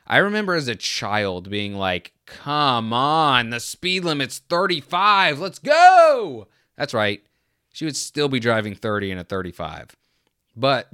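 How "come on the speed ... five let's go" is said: building from a whine to a much higher, louder yell on Let's go; impatient kid. Retold or imitated. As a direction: push harder each phrase like a restless kid in the back seat. Impatient child urging the driver.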